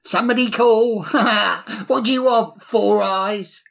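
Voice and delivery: put-on slurring, bold drunk bird voice; cheeky tipsy swagger, slurred; town drunk. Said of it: "Slurring his words, he said boldly".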